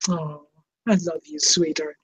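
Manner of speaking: soft, low, tender murmur to a pet; tender to the dog. Real, not acted. Holding her new puppy, a soft tender murmur to it. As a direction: melt over the little dog, softly.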